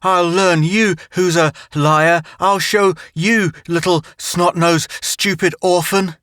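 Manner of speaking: put-on child shrieking back at his tormentor; screaming and crying. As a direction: scream it through tears. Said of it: "yelling, screaming, crying".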